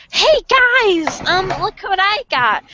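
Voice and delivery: put-on high, loud kid showing off; proud announcement. Kid showing parents the grabbed gum, announcing excitedly. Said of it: "literally announced".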